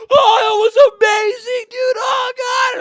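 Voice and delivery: loud, very high gushing shriek; exaggerated thrill. Cousins making him feel bad, shrieking exaggerated excitement. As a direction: rub it in with over-the-top elation. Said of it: "They exaggerate their experience".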